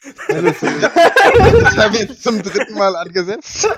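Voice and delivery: laughing while talking, slow; words breaking up into laughter. Real, not acted. Restarting intro, breaking up.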